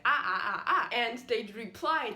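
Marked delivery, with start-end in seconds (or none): 0.1-0.9 classic four Chinese tones on "Ah"; short "Ah" syllables, each a different tone. Shown as a demonstration. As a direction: recite "Ah, ah, ah, ah", each on its own pitch contour. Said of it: "I'd give them the classic".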